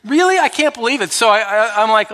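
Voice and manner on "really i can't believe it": much higher, louder mock disbelief; incredulous. Pastor retelling his reaction to the devotional, loud high incredulity. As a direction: act out being amazed it's happening. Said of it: "and I'm like".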